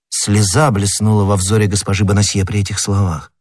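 ordinary delivery, nothing marked

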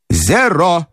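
croupier's call. A narrator's reading. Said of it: "крикнул крупёр".

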